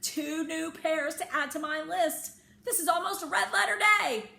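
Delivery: high, loud and laughing; shouting with excitement. A narrator's reading. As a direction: shout the discovery to your uncle. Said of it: "I began to shout again".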